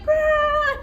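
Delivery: high piercing yelp, imitating the patient; retold wild yell. Nurse acting out the patient's wild high yelp. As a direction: let out a high wild cry. Patient yelling, going crazy.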